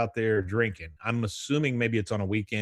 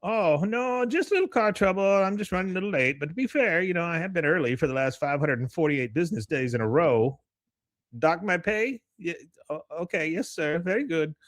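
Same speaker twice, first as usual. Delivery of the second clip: high-pitched excuses to the boss; flustered employee; 7 semitones higher than usual. Retold or imitated. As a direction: placate the boss on the phone, pitched way up. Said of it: "He goes, uh".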